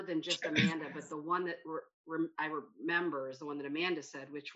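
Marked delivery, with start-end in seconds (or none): none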